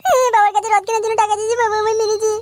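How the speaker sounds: put-on high, whining child's complaint; whiny crying child. Boy complaining to grandfather, high and whining. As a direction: blubber it out like a beaten kid. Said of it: "কাঁদছো কেন দাদু ভাই?".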